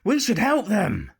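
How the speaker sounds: barely holding in his glee; pirate urging his captain to help the ladies, eager and loud; lustful excitement. A narrator's reading. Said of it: "barely able to contain his lustful excitement".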